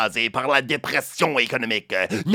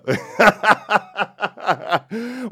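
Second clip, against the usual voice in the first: hearty laugh; 6 semitones higher than usual. Real, not acted. Host laughs at own joke.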